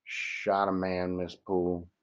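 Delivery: almost whisper the guilty news; a scared man admitting it quietly; hushed confession. A narrator's reading.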